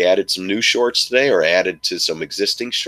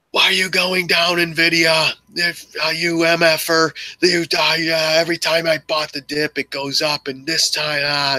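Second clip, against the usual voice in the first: yelling at screen; 10 semitones higher than usual. Retold or imitated. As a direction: rant at the screen like a frustrated trader. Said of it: "you sit there yelling at the screen".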